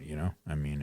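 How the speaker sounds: ordinary talk, nothing marked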